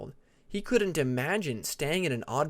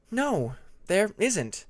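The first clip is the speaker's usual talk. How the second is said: deliberate, slow denial; unhurried answer to a question; slow explaining; 7 semitones higher, much slower than usual. A narrator's reading. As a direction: lay it out slowly and patiently. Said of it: "Tempest explained".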